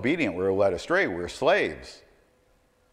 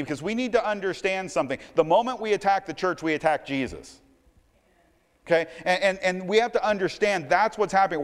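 ordinary delivery

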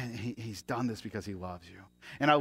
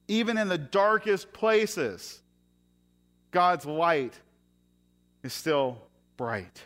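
rising, louder preacher's build; preaching; 8 semitones higher, 6 dB louder than usual. Acted. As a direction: proclaim it to the whole room. Opening of a sermon, preaching.

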